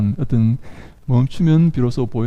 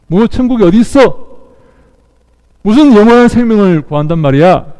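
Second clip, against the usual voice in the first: raised voice, mocking disbelief; acted devil's scolding, pitched up; scornful rebuke; 6 semitones higher, 5 dB louder than usual. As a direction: scoff loudly at the very idea. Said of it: "꾸짖고 있는 것입니다".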